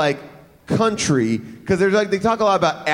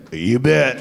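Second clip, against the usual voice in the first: deep sky voice; 7 semitones lower than usual. Retold or imitated. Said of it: "face should have reappeared and said".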